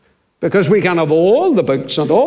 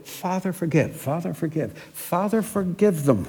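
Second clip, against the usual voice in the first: much softer, a quiet, pleading refrain; hushed repeated plea; 7 dB quieter than usual. Acted. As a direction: murmur it over and over, barely voiced. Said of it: "he kept saying".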